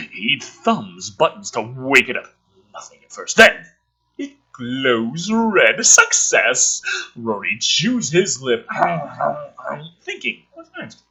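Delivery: hushed, eerie read on the creepy bits; spooky storyteller read; dramatic script reading. Acted. Reading screenplay aloud dramatically.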